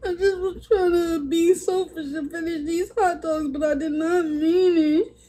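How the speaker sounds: real tears, pleading to be forgiven; sobbing sorry to the viewers; tearful apology. Real, not acted. Liz's tearful apology on live.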